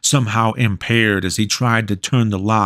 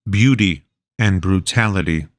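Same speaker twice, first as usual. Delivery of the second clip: ordinary delivery, nothing marked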